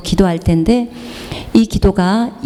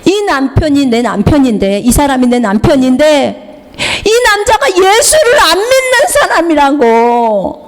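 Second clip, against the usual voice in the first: imitated wailing; 8 semitones higher than usual. Imitating a wife accusing her unbelieving husband, wailing. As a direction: mimic a wife crying out her grievance. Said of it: "막 우는 거예요".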